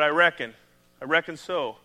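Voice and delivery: a light Southern country "I reckon", drawn out; country drawl. Retold or imitated. Pastor playing up his country theme, light Southern accent. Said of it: "That's my country theme".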